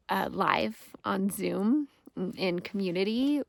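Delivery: ordinary talk, nothing marked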